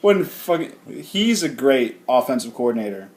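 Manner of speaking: loud, laughing rant. Real, not acted. Laughing rant about bad coach.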